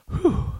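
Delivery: high blown-out sigh of relief; relieved whew. Retold or imitated. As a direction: imitate narrow-escape relief. Imitating relief when nothing happens.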